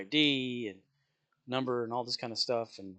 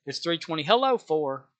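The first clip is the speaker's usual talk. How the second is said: hello blurted out louder mid-total; interrupted greeting; 5 semitones higher, 7 dB louder than usual. Retold or imitated. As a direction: break off the count to call out hello. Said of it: "have to stop and go".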